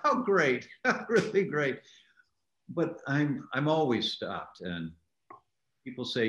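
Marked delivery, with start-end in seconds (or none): 0.0-1.8 high, pleased, a touch of laughter; delighted. Retold or imitated. Fan recalling a celebrity encounter, delighted. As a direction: savour it, beaming. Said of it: "I thought".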